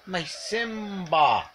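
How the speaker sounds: pet talk. Real, not acted. Owner calling the cat fondly.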